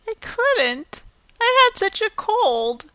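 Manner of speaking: high, thin tearful voice. Acted. Anne, shamed before Marilla, answers in tears. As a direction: speak through tears. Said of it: "Anne dissolved into tears".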